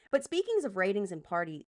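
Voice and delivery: normal talking voice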